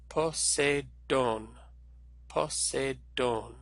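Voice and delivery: Poseidon drawn out syllable by syllable, po-say-DOHN; slow Greek pronunciation. Shown as a demonstration. Teacher leading students to say it along, slow po-say-DOHN for Poseidon. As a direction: say Poseidon very slowly for learners to follow. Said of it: "Now say it with me".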